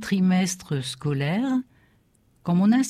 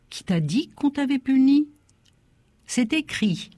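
lightly voiced, higher-pitched exchange; acted dialogue; 8 semitones higher than usual. A narrator's reading. Father questioning his son in class, mildly insistent. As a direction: raise the pitch for father and boy.

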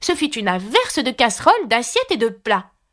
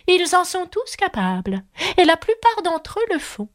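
higher haughty duchess voice; grand lady, pitched higher; 9 semitones higher than usual. Acted. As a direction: answer with lofty disdain.

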